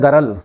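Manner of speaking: "गरल" said as garal, vowel inserted; village mispronunciation. Retold or imitated. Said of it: "लड़की को बोलते हैं गरल". (गाँव वाला उच्चारण "गरल")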